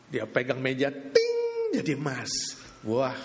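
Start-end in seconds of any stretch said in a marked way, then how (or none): 0.9-1.4 high sung chime; ping like a bell; magic ting. Acted. Object turning to gold with a high chime.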